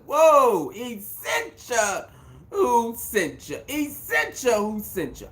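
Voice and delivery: high, drawn-out, playful hollering; mock hype holler. Host hoots at the runway clip, hollering playfully. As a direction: stretch it out like a teasing chant.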